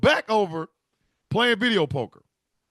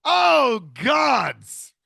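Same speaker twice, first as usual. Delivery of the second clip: higher, much louder cry of joy; joyful shout; 6 semitones higher, 6 dB louder than usual. Retold or imitated. Mother and son cheering over the jackpot. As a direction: cry out in delight.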